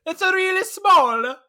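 put-on high, loud, slow caricature accent; Fabrizio's mock accent. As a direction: stretch it out loudly in a silly movie accent. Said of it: "Fabrizio ruining it with his accent".

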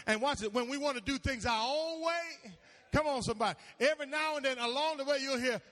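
loud, high, driving sermon; full-force preaching. Real, not acted. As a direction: fire up the congregation.

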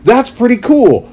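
high, bright-eyed praise; impressed reader. Retold or imitated. Reader admiring the premise, high excited praise. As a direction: gush about the story.

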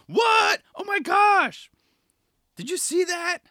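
high, loud shout of amazement; excited shock. Retold or imitated. Viewers reacting to footage, shouting in high excitement. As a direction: yell it like you can't believe your eyes. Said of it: "we go like".

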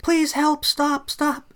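high, loud pleading cry; imitating her panicked begging; desperate plea. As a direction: beg the driver to stop. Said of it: "she's like".

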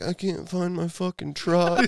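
high, drawn-out whiny wail; mock crying. Drunk guy complains to the officer about his lost truck, mock crying. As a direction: imitate a man blubbering over his lost truck. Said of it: "And he's crying".

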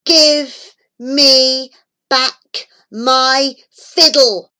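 loud, high, very slow demand; crying out. A narrator's reading. Unknown voice demanding from outside, slow loud cry. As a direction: cry each word out, long and drawn. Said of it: "A voice cried out".

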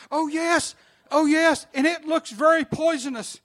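high, loud, frightened insisting; insistent alarm. Retold or imitated. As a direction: insist in alarm that the snake is real.

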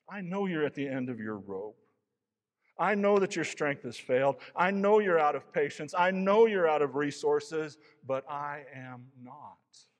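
building divine reassurance. Acted. God reassuring exhausted Elijah, building loud then slowing. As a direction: swell with God's assurance, then settle.

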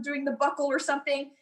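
usual speaking voice

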